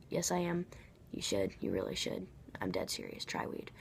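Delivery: a sneaky murmured aside to the viewers; conspiratorial aside. Acted. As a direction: slip the real advice in under your breath. Sneaky aside contradicting herself.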